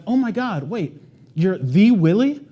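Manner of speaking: be starstruck meeting a celebrity; starstruck surprise. Retold or imitated. Lady at training recognises Willie, slow starstruck surprise.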